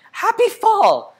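high, drawn-out happy shout; big high-pitched call, stretched out slowly; loud joyful shout. A narrator's reading. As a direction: shout it as loudly as you can. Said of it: "he shouted as loudly as he could".

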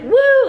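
high, loud "woo" of glee; happy baby yell. Retold or imitated. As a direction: imitate a baby's joyful whoop. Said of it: "yelling happiness and".

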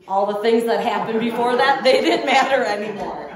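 loud, laughing burst of joy; excited. Real, not acted. Joyful release after the story.

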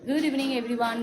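ordinary talk

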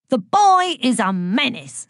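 indignant bullying teacher; indignant denunciation. A narrator's reading. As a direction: condemn the pupil with relish. Angry teacher denounces pupils.

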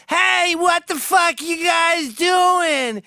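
high, slow, loud yell at the intruders; Nancy shouting at the thieves; startled shout. Retold or imitated. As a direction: scream it out, woken and furious. Nancy wakes to robbers.

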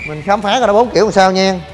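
usual speaking voice

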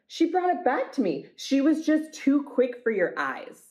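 a touch of defensive boasting; insistent dog owner. Acted.

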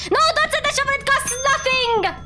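urgent, very high squawked warning; high-pitched protest. Real, not acted. Gamer stops teammate selling the shovel, high-pitched protest. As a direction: cry out in a very high voice, stretching the end.

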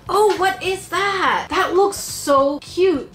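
high, slow gasp of delight; delighted surprise. Real, not acted. YouTuber unboxing Sarah's bath bomb kit, high delighted surprise. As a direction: melt over something adorable.

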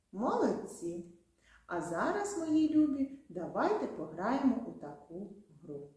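ordinary talk

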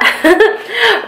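a bright laugh that falls away; high laugh. Real, not acted. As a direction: laugh freely at the idea. Laughs about her water bottle idea.

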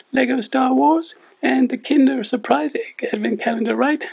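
squeaky, high cartoon puppet sidekick. Puppet co-host Lani speaks.

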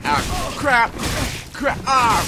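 high, loud yelps of panic; loud frantic cursing; alarmed. Real, not acted. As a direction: yelp in short bursts as the hits land. Getting hit in the game.

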